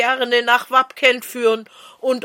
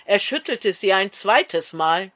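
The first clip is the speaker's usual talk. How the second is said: ordinary delivery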